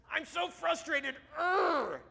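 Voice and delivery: high, drawn-out frustrated cartoon voice; Daniel Tiger. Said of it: "out of my ear I hear".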